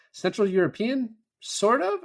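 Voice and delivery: imitated doubtful question, pitch climbing very high; hesitant guess swooping up at the end; unsure guess. Cop guessing at the language on the tape, unsure.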